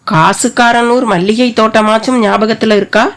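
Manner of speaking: plain reading voice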